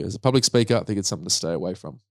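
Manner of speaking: normal talking voice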